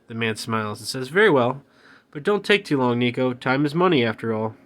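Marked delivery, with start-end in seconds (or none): 1.1-4.5 smug businessman. Acted. Villain businessman warning Nico.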